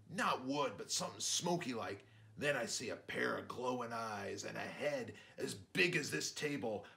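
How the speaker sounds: dad telling a tale. Acted. Dad describing the beast.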